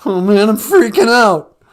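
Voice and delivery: a scared caller losing his nerve; Cowardly Lion freaking out. Acted. Radio caller panicking about the vaccine, loud and high. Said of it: "it's a caller, Cowardly Lion".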